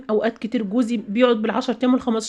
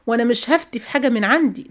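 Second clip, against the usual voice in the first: ordinary delivery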